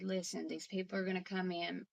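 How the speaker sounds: quietly prepare a sick parent for strangers; tender hushed reenactment; gentle reassurance. Retold or imitated. Daughter gently reassures her dying father.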